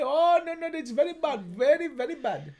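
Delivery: put-on lamenting parent in an Indian accent. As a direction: tut it with an Indian lilt. Indian parent act continues.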